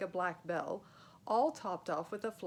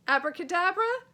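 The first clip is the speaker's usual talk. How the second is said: high, loud hopeful cry; desperate spell; 10 semitones higher, 13 dB louder than usual. Acted. Joe desperately tries a spell on the charging knight. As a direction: fling out the word, hoping it works.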